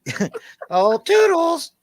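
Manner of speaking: very high squeaky cartoon mouse voice; Mickey Mouse impression. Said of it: "Mickey Mouse".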